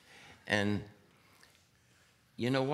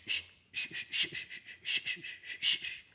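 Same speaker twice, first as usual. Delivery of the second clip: breathy shh on two and four; hi-hat shh rhythm. Shown as a demonstration. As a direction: hiss the hi-hat beat. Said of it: "Bill was putting".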